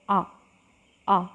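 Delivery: आ held open and long; vowel demonstration. Shown as a demonstration. Teacher demonstrates the central vowel, held long. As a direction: sound out आ slowly for the class. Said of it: "बोल के देखेंगे हम आ".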